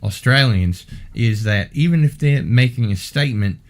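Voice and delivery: mock Australian with a questioning lift at the end; put-on Australian uptalk; Australian accent. Shown as a demonstration. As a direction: demonstrate an Australian accent, ending statements like questions. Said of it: "That's how you do an Australian accent".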